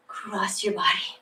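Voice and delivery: whispered repeat. Shown as a demonstration. Instructor whispering the cue to the class. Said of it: "and across your body".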